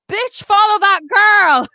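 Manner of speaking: high, loud, drawn-out holler; urgent calling out. Retold or imitated. Barista to coworker as customer flees, urgent high holler. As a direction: send a coworker running after her. Retold order to chase a thief.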